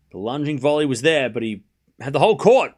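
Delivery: ordinary talk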